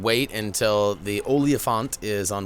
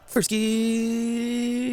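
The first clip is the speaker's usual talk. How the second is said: chipmunk-high sped-up voice; played back fast and squeaky; sped-up audio. Shown as a demonstration. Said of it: "I'm speeding it up each time I do this".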